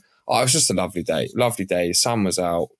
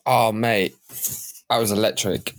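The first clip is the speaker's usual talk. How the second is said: ordinary talk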